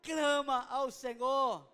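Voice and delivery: loud, shouted exhortation; full-force preaching. Acted. Preacher urging the congregation, shouting the call to keep crying out. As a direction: drive the church to cry out.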